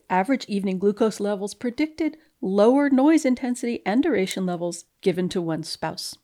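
normal talking voice

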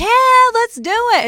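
high, loud whoop of agreement; excited onlookers. Retold or imitated. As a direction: imitate friends eagerly joining in. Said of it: "people are like".

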